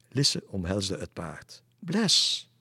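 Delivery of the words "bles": very high, loud delighted shout; girl hugging her horse, overjoyed; joyful cry. Acted. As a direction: cry out the horse's name with joy.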